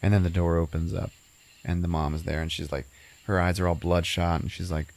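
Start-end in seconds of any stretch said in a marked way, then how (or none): none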